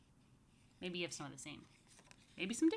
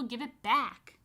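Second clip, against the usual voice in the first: put-on louder child demanding; upset demand; 4 semitones higher, 8 dB louder, slower than usual. Michael to Rachel, loud and upset. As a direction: insist like a robbed kid. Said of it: "cried Michael".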